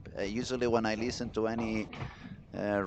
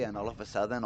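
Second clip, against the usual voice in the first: ordinary talk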